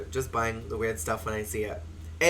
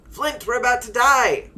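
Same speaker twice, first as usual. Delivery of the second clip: high, loud cry of doom; hammed-up panicked shout; 10 semitones higher, 8 dB louder than usual. Trucker warning his twin brother of death, hammed-up panic. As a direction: shout it high like a melodramatic TV ghost hunter. Acted TV trucker in mortal danger.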